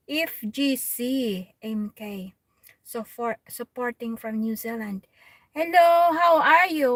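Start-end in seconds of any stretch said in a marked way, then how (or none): 5.5-6.9 higher, louder happy hello; bright, loud streamer greeting; excited greeting. Real, not acted. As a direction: call out to the viewer warmly. Greeting viewer on live stream.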